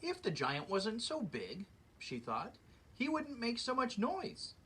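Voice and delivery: lively read-aloud storybook voice; story narration. A narrator's reading. Reading a fairy tale to children, lively storyteller voice. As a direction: read it like story time for kids.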